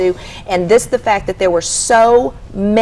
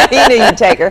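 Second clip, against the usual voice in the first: laughing; 6 semitones higher, 9 dB louder than usual. Real, not acted. Laughing at the five dollar bet.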